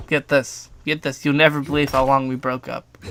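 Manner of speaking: ordinary delivery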